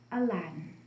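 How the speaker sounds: ordinary delivery, nothing marked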